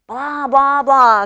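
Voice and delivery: loud, drawn-out blah noises; TV babble. A narrator's reading. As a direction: imitate a blaring television. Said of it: "goes the T V".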